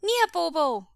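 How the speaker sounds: put-on high, loud child greeting; little child's cry. A narrator's reading. Small boy spots his relative in the hall, delighted cry. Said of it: "cried a familiar little voice".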